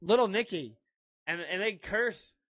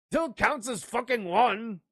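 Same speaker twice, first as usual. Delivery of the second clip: mock film quote; 6 semitones higher than usual. Sweary film quote, acted.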